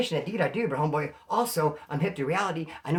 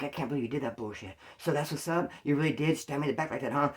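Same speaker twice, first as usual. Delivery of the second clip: hushed accusation; 2 semitones lower, 6 dB quieter than usual. Retold or imitated. Sneaky angry call in office.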